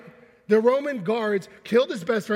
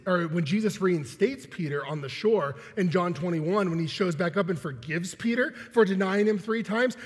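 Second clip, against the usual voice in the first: normal talking voice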